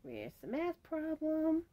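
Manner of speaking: high, soft comic voice; catchphrase parody. Acted. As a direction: put on a high, small voice for the catchphrase. Playing on an old ad catchphrase.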